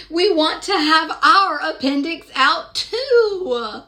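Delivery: gentle, sing-song picture-book narration; storybook reading. Acted. Reading Madeline aloud to young children, sing-song storytelling. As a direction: read the rhyme aloud to little listeners.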